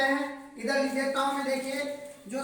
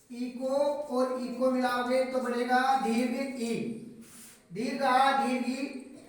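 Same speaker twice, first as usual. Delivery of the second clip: slow demo; slower than usual. Shown as a demonstration. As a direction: dwell on दीर्घ आ and दीर्घ ई, very slow. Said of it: "slow demonstration of long vowels". (धीरे धीरे समझाते हुए)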